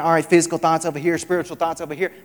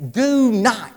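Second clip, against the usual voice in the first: emphatic warning; 6 semitones higher, 7 dB louder than usual. Acted. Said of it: "Jesus says".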